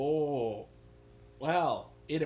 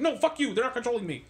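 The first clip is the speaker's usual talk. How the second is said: defensive retort; 6 semitones higher than usual. Retold or imitated. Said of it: "He's like".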